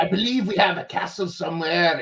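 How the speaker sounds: a lightly put-on Scottish accent; terrible Scottish accent. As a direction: do a bad Scottish accent for fun. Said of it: "That's my terrible Scottish accent".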